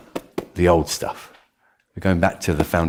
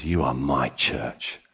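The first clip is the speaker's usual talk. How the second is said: solemn voice of Jesus; 6 semitones lower, 4 dB quieter than usual. Retold or imitated. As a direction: speak with calm divine authority. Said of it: "he says".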